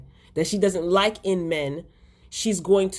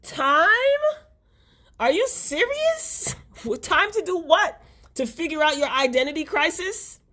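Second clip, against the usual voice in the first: incredulous outburst; 11 semitones higher than usual. Real, not acted. Vlogger yelling back at Calvin, high incredulous outburst. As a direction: shriek it in outrage. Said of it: "And I'm like".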